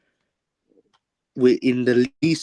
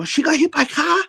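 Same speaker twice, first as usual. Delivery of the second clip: very high shrieky mock Chinese accent; hammed-up Chinese-accented disbelief, pitched way up; Chinese guy. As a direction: panic in a caricatured Chinese accent. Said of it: "the Chinese sort of says".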